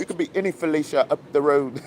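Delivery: quick, high-pitched imitation of a UK accent; mock UK accent. Repeating the clip speaker's line for laughs, mock UK accent. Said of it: "Y'all accents saying that Felicia is hilarious".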